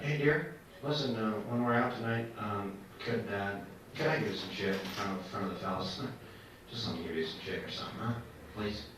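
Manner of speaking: soft, low, hesitant asking; timidly sneak in a request to the wife; mock-meek, hushed husband stumbling over his words; wimpy husband pleading. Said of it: "I was a wimp in marriage".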